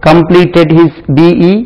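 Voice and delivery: each word drawn out slowly for students; slow dictation. Shown as a demonstration. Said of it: "Has he".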